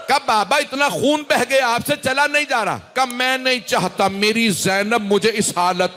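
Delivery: high, sustained mournful chant; masaib recitation. Acted. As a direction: carry the mourners into grief with a lifted, keening voice. Masaib recital rising to lament. (بلند، درد بھرا مرثیہ خوانی کا انداز)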